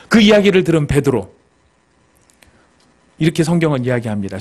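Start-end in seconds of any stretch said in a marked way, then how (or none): none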